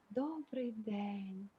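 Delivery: very slow, hushed, drawn-out greeting; imitating a serene voice. Meditation organiser answering the phone to a troubled caller, imitated very soft and slow. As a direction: stretch it out softly, imitating her calm phone manner. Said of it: "вона мені говорить таким голосом".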